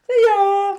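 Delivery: high, loud, hammed-up goodbye; breezy farewell shout. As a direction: call it out like someone dashing off. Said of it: "Ben and Polly just were like".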